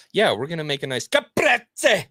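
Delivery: mock Italian accent. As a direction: ham up the Italian pronunciation. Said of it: "I hate it when Italians go".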